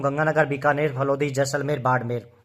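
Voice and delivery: speed-recite like a drill; fast and loud. Shown as a demonstration. Teacher shows students how to recite fast and loud. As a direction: rattle off the names quickly. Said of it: "जोर-जोर से आप fastly बोलोगे".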